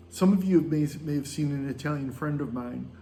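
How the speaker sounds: ordinary talk, nothing marked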